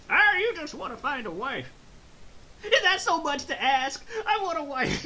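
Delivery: high, loud mock pleading; hammed-up desperate outburst; Krillin. Acts out Krillin pleading for a wife.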